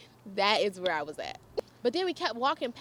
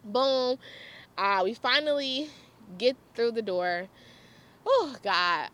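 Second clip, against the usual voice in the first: normal talking voice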